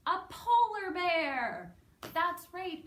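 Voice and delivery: high, loud, slow and thrilled announcement; excited reveal. Acted. Teacher excitedly reveals the polar bear to children. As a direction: burst out with the answer like a big surprise.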